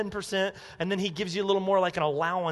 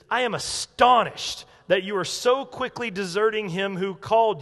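raised, emphatic mock yell; righteously mad; 3 semitones higher, 4 dB louder than usual. Preacher voicing Paul's letter to the Galatians, righteously mad. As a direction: scold them with righteous indignation. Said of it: "He's yelling and he's mad".

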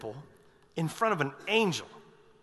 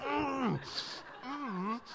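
soft, closed-mouth hums trying to speak; the speechless priest tries to talk with his mouth shut; mute straining hums; 6 semitones higher, 10 dB quieter than usual. Retold or imitated. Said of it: "this guy going".

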